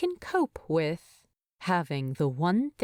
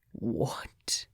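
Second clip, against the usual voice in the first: astounded whispered What; harsh whisper; 8 semitones lower, 6 dB quieter than usual. Acted. Bilbo stunned by Thorin's words, a harsh disbelieving whisper. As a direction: breathe it out in disbelief. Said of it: "A harsh whisper, astounded".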